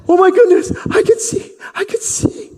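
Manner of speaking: high, loud burst of joy; overjoyed. Acted. Healed man discovering sight, overjoyed and high. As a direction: gasp it out in amazement. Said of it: "he's just like".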